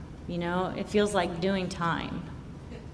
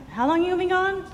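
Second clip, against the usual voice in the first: mock skeptical question; 11 semitones higher than usual. Mock questioning the boat friends.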